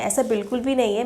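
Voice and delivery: ordinary talk, nothing marked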